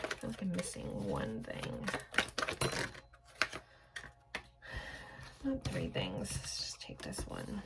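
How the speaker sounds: very soft, low self-talk; muttering to oneself. Real, not acted. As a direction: murmur absently while searching the cards. Muttering to herself while drawing cards.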